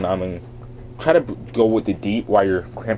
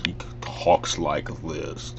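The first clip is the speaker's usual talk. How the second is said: low, slow, softer villain voice; 4 semitones lower, 5 dB quieter, slower than usual. Shown as a demonstration. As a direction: imitate a cartoon villain, dragging the words.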